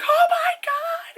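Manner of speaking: very high, loud, drawn-out squeal; hammed-up panicked cry; mock horrified shriek. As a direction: shriek in pretend horror. Mother's horror, retold.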